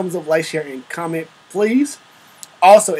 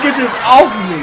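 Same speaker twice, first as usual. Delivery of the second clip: loud, high mock outcry; imitating the comedian; 5 semitones higher, 7 dB louder than usual. Viewer repeating the comedian's punchline, imitating his outcry. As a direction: mimic a comic's yelled complaint, amused. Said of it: "He's like".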